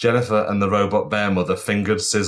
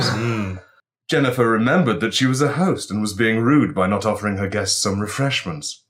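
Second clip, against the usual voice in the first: ordinary delivery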